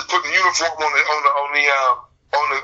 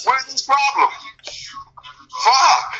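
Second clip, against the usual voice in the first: exasperated outburst; 6 semitones higher, slower than usual. Retold or imitated. Repeats his outraged question.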